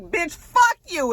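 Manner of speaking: high-pitched, drawn-out defiant insult; mock cursing. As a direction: imitate a defiant curse mid-fight, amused. Acts out Clifford cursing in fight.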